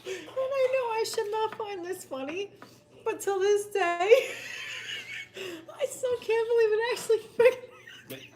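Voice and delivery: high-pitched, laughing through the words; helpless laughing. Real, not acted. Admitting it shouldn't be funny, helpless laughing. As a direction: barely get it out for real laughing. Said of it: "I can't tell the story without laughing".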